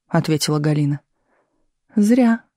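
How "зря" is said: dismissive scoff. A narrator's reading. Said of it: "хмыкнула Любовь Ивановна".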